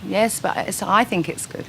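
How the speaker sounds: ordinary talk, nothing marked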